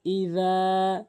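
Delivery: ইযা with the long a drawn out one alif; stretched vowel. Shown as a demonstration. Teacher showing how to stretch ইযা, drawn-out vowel. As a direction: hold the যা of ইযা long and slow. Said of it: "এক আলিফ টেনে পড়বেন".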